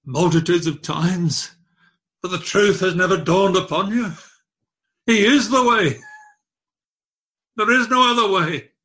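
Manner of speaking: high, loud climax; impassioned preaching. Acted. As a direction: build to a ringing cry. Preaching at full force.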